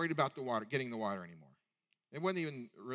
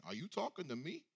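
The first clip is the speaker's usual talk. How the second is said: light mock Italian tough-guy accent, a bit softer; 3 semitones higher, 4 dB quieter than usual. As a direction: play it like an Italian movie gangster. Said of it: "that Italian accent".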